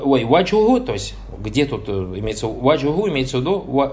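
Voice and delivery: normal talking voice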